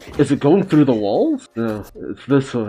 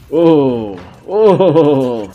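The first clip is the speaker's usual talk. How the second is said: startled oh; 3 semitones higher, 8 dB louder than usual. Real, not acted. Startled by climbing demon.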